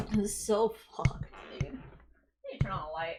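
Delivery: soft unvoiced whisper; whispered late-night aside. Real, not acted. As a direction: whisper it to yourself.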